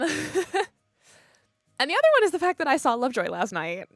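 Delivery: loud, high, stretched-out excitement; loud excited boast; excited reveal. Real, not acted. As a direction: burst out with big news, drawing it out. Breaking big news about a gig.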